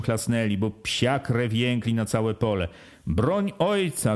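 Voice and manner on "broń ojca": high, slow alarmed cry; a higher, slowed shout for help. A narrator's reading. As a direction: yell it out to rouse help. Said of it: "krzyczą".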